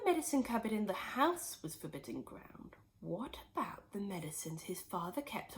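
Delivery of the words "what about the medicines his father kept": soft unvoiced whisper. A narrator's reading. Narrator hints at George's sly plan, whispered. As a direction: let the secret slip out in a whisper.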